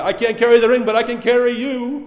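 high, loud, earnest plea; heartfelt Sam quote. Retold or imitated. Sam to Frodo on the mountain, high earnest vow. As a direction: raise it up as Sam vowing to help. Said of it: "What does it say in The Lord of the Rings?".

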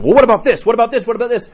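high, loud badgering questions; challenging. Priest imitating a parishioner badgering him with questions. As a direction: mimic him pestering, pitch way up. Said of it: "he starts challenging".

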